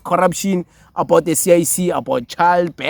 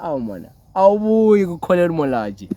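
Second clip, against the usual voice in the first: ordinary talk, nothing marked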